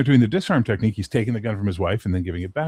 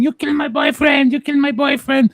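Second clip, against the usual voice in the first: put-on very high, loud shrieking girlfriend; imitated screaming; 5 dB louder than usual. Girlfriend beating bouncer with her shoe, imitated hysterical screaming. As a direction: mock-scream in a piercing high voice.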